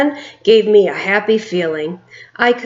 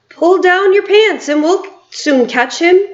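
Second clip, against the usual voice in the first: shouting; 9 semitones higher, 3 dB louder than usual. A narrator's reading. Other cook shouting advice.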